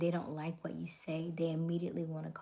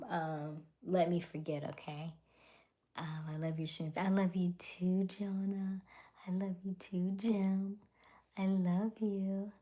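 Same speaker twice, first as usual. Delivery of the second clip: normal talking voice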